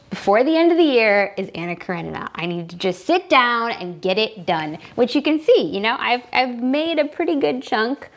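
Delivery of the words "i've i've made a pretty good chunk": usual speaking voice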